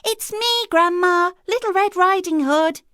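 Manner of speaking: put-on the girl announces herself at grandma's door, high and loud; little girl calling. A narrator's reading. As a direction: call through the door as a bright child. Girl calls through the door.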